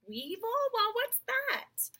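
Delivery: high, rising puzzled voice; baffled questioning. A narrator's reading. Creatures puzzling over a new word, baffled. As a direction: lift it high in bewilderment.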